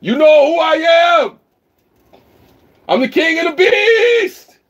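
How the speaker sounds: very high, loud beast roar; roaring at the neighbors for fun; mock roaring yell. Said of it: "yell at the neighbors again".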